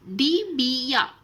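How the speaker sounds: डिबिया said slowly, syllable by syllable; teacher modelling डिबिया slowly; careful pronunciation. Shown as a demonstration. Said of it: "उच्चारण करके आपको शब्द पहचाना".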